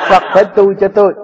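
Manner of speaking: amused laughing delivery. Real, not acted. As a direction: let the laugh carry the words. Laughing at own joke.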